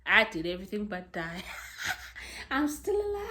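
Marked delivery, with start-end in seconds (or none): 2.0-3.3 high squeal of laughter; real giggly squeal; laughing. Real, not acted. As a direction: laugh through the words, pitch shooting up. Laughing after near-death remark.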